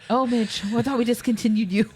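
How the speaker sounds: mild mock scream. Will Ferrell seeing pregnant Midge, mild mock scream. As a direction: yelp it like a spooked boss. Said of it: "then screams".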